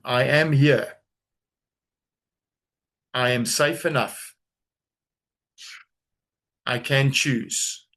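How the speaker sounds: slow, deliberate affirmation; said slowly. Shown as a demonstration. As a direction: let each word land slowly. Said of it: "say this slowly".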